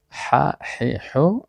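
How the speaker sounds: ح، ح، حو as breathy throat h with a, i, u; three vowelled forms of ح. Shown as a demonstration. Teacher showing the three states of ح, throaty syllable demonstration. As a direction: set each ح syllable apart, friction in the throat. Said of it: "هم شکل تلفظ".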